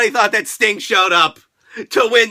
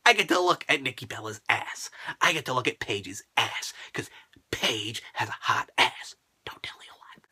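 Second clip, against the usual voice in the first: soft sly whisper; hushed cheeky whisper; whispered aside; 12 semitones lower, 11 dB quieter than usual. Real, not acted. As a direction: lean in and whisper it. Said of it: "lecherous aside".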